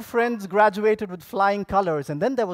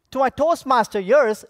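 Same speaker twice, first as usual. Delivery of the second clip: ordinary talk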